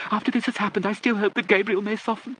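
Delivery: very high-pitched aristocratic lady; deaf old lady. A narrator's reading. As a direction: play a deaf Lady Catherine, pitched far up.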